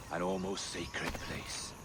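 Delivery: soft and slow, almost hushed; a quiet, slow reverence; reverent hush. Acted. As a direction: speak of it as holy ground. Roberts reveres the observatory.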